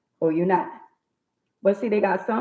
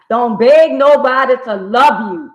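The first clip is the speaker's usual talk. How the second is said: louder, punching beg in a near shout; loud emphatic; 6 semitones higher, 11 dB louder than usual. Real, not acted. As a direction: drive the point home with force. Preaching point to viewers, rising.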